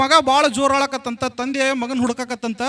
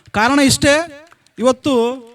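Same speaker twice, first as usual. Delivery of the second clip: loud emphatic; 3 semitones higher, 6 dB louder, much slower than usual. Shown as a demonstration. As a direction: hammer ಇಷ್ಟೇ home to the crowd. Repeated rhetorical lead-in, punched.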